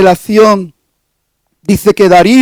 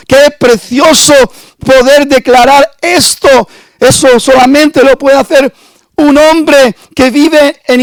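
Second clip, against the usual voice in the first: rising preaching fervor; 4 semitones higher than usual. Acted. Preacher exalting a man of integrity, building fervor. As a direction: swell into a fervent exclamation of praise.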